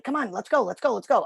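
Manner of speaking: mock hurry-up, pushing the dog along; impatient hurrying. Showing how not to rush a dog, impatient hurrying. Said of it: "I wasn't like".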